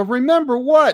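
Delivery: high-pitched baffled question; clueless disbelief. Retold or imitated. Drinker answers the job seeker, baffled. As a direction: act out having no idea.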